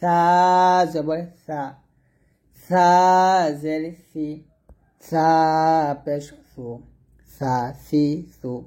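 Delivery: সা light, tongue tip on upper teeth; slow drill, long সা then pause; lisped "thaa" সা, thin and measured; thin Tha. Shown as a demonstration. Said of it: "সা পাতলা করে উচ্চারণ করবেন".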